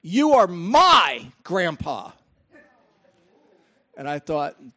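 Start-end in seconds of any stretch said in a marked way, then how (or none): 0.0-2.1 slow, louder demanding "You are my grandpa"; insistent exclaim. Retold or imitated. Granddaughter claiming grandpa, slow insistent exclaim. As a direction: plant each word like a stubborn little girl. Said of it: "she did not say it nicely".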